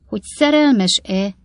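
loud, drawn-out rhetorical question; indignant echo. Acted. Father echoes the question, indignant.

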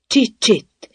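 high, urgent shushing; hushing warning. A narrator's reading. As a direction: shush someone nervously. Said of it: "intette le a nyuszi".

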